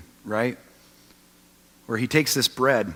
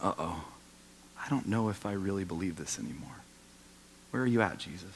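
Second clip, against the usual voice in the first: hushed doubt; 6 semitones lower, 13 dB quieter than usual. Retold or imitated. Doubting believer's inner voice.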